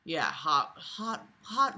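ordinary delivery

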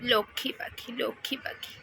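soft, low cooing to a pet. Real, not acted. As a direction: coo it gently like soothing a bird. Soothing the sulking pet bird.

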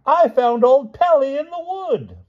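high, loud, slow hearty call; jovial shout. A narrator's reading. Stout lady presents her find, shouting jovially. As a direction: announce it with booming good cheer. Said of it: "she shouted joy-, jovially".

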